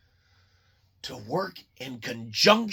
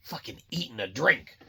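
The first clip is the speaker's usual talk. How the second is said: low scheming executive; 7 semitones lower, 6 dB quieter than usual. Retold or imitated. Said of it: "they're like".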